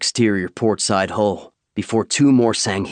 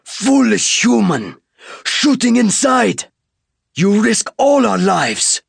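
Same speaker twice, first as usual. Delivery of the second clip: furious alien scolding; 13 semitones higher, 5 dB louder, slower than usual. Acted. Alien scolding human for shooting.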